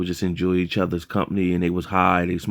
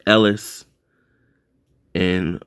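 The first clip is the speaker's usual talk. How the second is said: ordinary delivery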